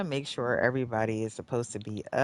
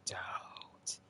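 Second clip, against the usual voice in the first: voiceless, much softer plea; whispered warning; 13 dB quieter than usual. Real, not acted. Player telling the game don't, whispering. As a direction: drop suddenly into a whisper.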